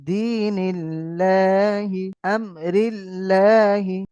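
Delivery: "আমরিল্লাহি" chanted slowly with a light thin "লা"; slow drawn recitation of "আমরিল্লাহি" with thin lam. Shown as a demonstration. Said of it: "অর্থাৎ বারিক".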